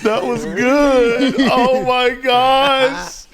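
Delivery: high, slow, drawn-out with laughter; excited laughing praise. Real, not acted. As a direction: gush in laughing disbelief. Stunned by opponent's speech.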